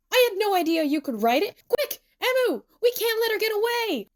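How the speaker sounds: high, rising call to action; urgent shout. A narrator's reading. Tsukasa urging Emu to chase Nene, urgent shout. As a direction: raise the pitch and rally your partner. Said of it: "Tsukasa shouts".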